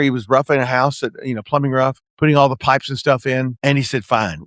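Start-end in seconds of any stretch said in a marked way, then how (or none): 4.0-4.4 low, short, closed-off fine; a mumbled, guarded brush-off; curt fine. Retold or imitated. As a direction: clip it off like you don't want to talk. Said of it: "that's the way he said it".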